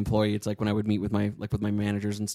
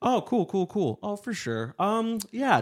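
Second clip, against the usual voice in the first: high, put-on agreeable murmurs; lilting, high placeholder agreement; mimicked filler chatter; 9 semitones higher, slower than usual. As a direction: fill the silence with mock enthusiasm. Mimics own nervous filler talk.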